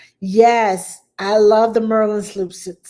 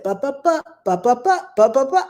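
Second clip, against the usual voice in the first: imitating baby babble; 4 semitones higher, 4 dB louder than usual. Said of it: "She said".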